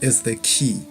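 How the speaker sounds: unhurried, hushed phrases, guiding the listener inward; guided meditation. Real, not acted.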